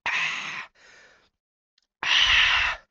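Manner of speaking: sharp unvoiced gasps for air; gasping, winded, out of breath; breathless gasps. Real, not acted. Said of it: "j'ai plus de souffle".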